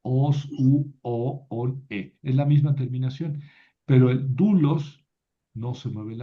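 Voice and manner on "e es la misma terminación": usual speaking voice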